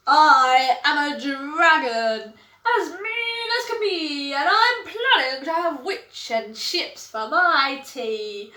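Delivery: loom over the witch as a mean dragon; menacing dragon. Acted. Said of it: "dragon character announces menace".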